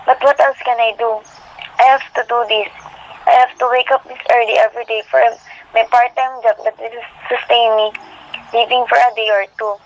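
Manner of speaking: ordinary delivery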